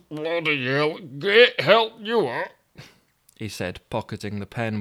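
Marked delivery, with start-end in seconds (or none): 0.1-2.5 higher Yorkshire voice, indignant; a put-out northern man, lost in London; Toby grumbling in Yorkshire voice; grumpy Yorkshireman Toby. Acted.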